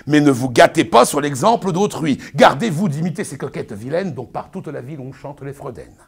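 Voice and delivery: pompous Arnolphe, lecturing his bride; booming, sermonizing stage declamation; hammy stage actor. Acted. Continues Arnolphe's tirade.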